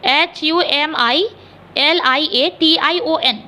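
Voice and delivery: very slow, letter by letter; spelling out letters. Shown as a demonstration. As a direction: dictate each letter slowly to students. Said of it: "humiliation कैसा होता है". (एक एक अक्षर धीरे धीरे बोलना)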